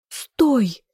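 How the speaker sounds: high-pitched command to halt; furious shout. A narrator's reading. Heroine defying the fog again, furious shout. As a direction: cry out fiercely. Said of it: "вновь яростно закричала я".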